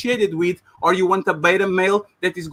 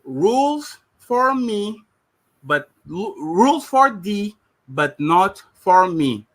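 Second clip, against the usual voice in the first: rules for me spelled out slowly, word by word; slow pointed jab; much slower than usual. Real, not acted. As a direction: drag each word out to make the point. Pointed jab in heated debate.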